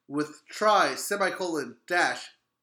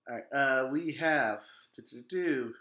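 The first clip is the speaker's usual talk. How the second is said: ordinary delivery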